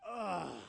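groggy, exasperated "ugh"; weary groan. Acted. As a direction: groan at being woken too early. Woken early, looks at clock.

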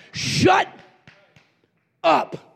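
high, loud, two separate blasts; commanding shout. Acted. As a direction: order hell silent, word by word. Preacher orders hell to silence.

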